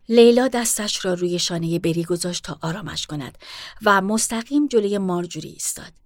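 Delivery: ordinary delivery, nothing marked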